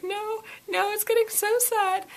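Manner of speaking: high, whiny mock lament; mock whimper. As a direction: whine it like a reader in despair. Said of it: "I'm just like".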